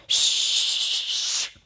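sustained "shh" hiss; long shush. Retold or imitated. Henry coming out of hiding, long shush. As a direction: hush with a steady breath.

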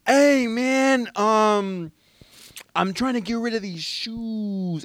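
high, slow put-on voice; homeless guy voice. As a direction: raise the pitch and drag it out. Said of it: "He goes".

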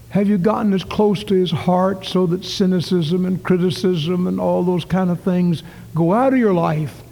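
ordinary talk, nothing marked

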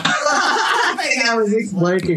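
high, drawn-out laughing delivery; slow words squeezed through laughter; cracking up. Real, not acted. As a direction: dissolve into high laughter while saying it. Repeats own joke, cracking up.

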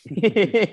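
risa breve y aguda. Real, not acted. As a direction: se ríe de la anécdota. Laugh after jamón punchline. (risa aguda espontánea)

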